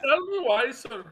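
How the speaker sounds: badgering in a mock Indian accent. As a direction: press the question in a put-on Indian accent. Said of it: "talking in a fucking Indian accent".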